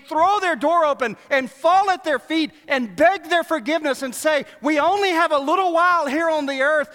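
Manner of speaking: loud, high, driving sermon voice; preaching at full force. Real, not acted. Preacher urging the church to forgive, loud full-force preaching. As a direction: preach it hard to the congregation.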